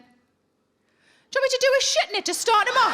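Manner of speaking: mock earnest boyfriend offering help; imitating fella. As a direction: chirp it eagerly, way up high. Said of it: "he looked down at the litter tray and he went".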